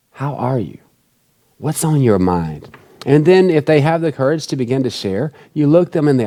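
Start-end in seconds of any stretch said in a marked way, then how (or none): 0.1-0.8 soft, caring check-in; sincere question. Shown as a demonstration. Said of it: "Not the insincere". 1.6-2.7 low, slow, caring question; sincere question. Shown as a demonstration. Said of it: "I mean like".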